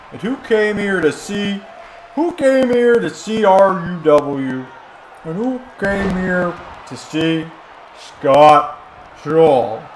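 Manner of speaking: building ring announcer call, loud finish; hype announcer. Acted. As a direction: rile the crowd up like a ring announcer. Hyping the crowd like announcer.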